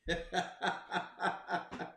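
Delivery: breathy amused chuckle; quiet laugh at the nonsense; soft laugh. Real, not acted. Laughing at critics' reading.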